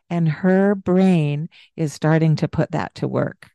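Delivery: normal talking voice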